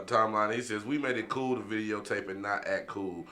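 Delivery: usual speaking voice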